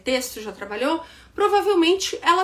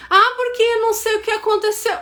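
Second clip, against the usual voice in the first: mock vague excuse; 7 semitones higher, 3 dB louder, faster than usual.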